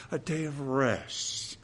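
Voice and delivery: hushed, slow and relishing; savouring softly. Real, not acted. Preacher savouring the promised rest with his congregation, hushed and slow. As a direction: let it out softly, like a relief.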